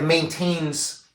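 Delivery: normal talking voice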